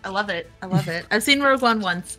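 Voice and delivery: ordinary talk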